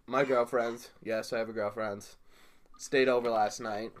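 ordinary delivery

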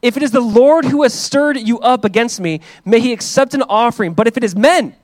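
loud, high, proclaiming Bible reading; fervent scripture reading. Acted. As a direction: read it out like from the pulpit. Reads scripture passage aloud.

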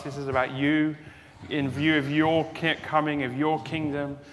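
loud, fervent prayer to the congregation; closing prayer. Acted. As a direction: pray it out boldly for the whole room.

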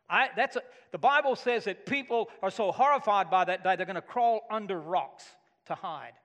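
loud, driving sermon peak; rising revival preacher cadence; preaching at full force. Acted. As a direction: pound each promise home to the congregation.

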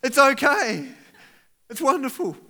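high, bright mock relief; relieved delight. Car owner finding the car, high mock delight. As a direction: act out the joy of finding the car. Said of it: "you're like".

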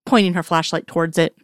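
usual speaking voice

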